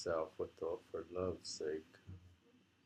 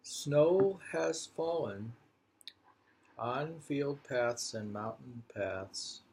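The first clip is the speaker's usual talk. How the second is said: poem recital; 5 dB louder, slower than usual. Acted. Rereading the snow poem to a friend, slow declaiming.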